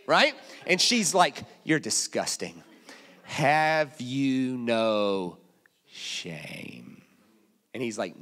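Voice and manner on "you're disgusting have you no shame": low, dragged-out scolding, shame sinking very low; hammed-up disgusted wife. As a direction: drawl out the rebuke like an appalled wife.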